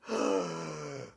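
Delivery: big gasp after running out of breath; real gasp for air after a one-breath rant. Real, not acted. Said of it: "I said that all in one breath".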